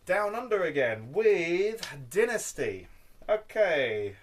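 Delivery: loud, high, drawn-out title reveal; showy announcement. Pretend. Collector unveiling his next record to viewers, grandly announced. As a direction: announce it with a big slow flourish.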